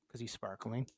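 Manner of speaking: normal talking voice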